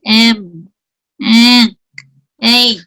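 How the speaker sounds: humming the nasals with nostrils pinched closed; nose pinched shut. Shown as a demonstration. Teacher shows students nasals with pinched nose, muffled. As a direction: try em through a blocked nose. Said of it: "Si la obstruyo".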